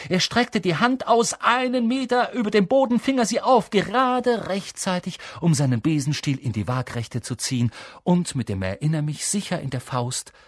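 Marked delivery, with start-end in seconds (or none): 0.1-5.2 high, urgent storytelling; thrilled, pitched-up narration; breathtaking excitement. A narrator's reading. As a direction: narrate the climax with rising tension. Climax of the diving catch.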